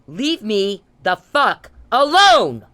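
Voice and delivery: slow, loud, every word hammered; furious demand. Real, not acted. Telling Mister Perry to back off, furious and slow. Angry rant at harasser.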